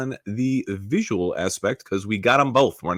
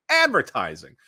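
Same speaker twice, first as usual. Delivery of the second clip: usual speaking voice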